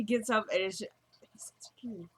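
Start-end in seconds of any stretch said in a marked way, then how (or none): none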